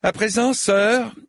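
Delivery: high, commanding call; cried out. A narrator's reading. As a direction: call out a challenge. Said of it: "cria sa seigneurie". (cri impérieux)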